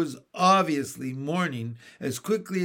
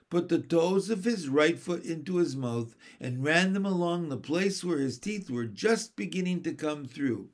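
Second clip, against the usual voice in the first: usual speaking voice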